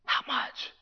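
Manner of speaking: sudden hushed whisper after the shouts. Real, not acted. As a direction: drop to a breathless whisper.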